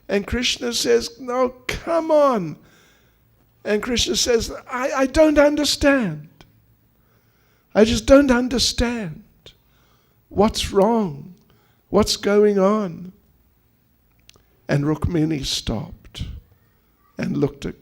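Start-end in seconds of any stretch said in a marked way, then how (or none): 1.2-13.1 high-pitched, acted bewilderment; baffled pleading. Husband baffled by his wife's crying, high bewildered pleading. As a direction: implore her, utterly at a loss. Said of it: "He couldn't understand why she was crying".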